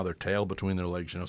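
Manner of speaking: ordinary talk, nothing marked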